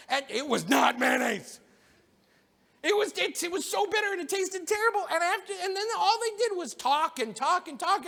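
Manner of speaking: mock grievance of a hungry guest; indignant rant. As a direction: keep complaining about the meal. Guy continues his indignant complaint.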